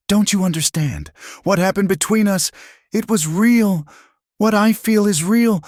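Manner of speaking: very high, impassioned plea; raw emotional outburst. A narrator's reading. Man insisting his feeling is real, raw and impassioned. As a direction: plead at the top of the range. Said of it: "his voice raw with an emotion".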